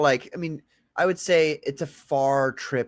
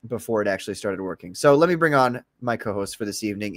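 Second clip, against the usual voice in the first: ordinary talk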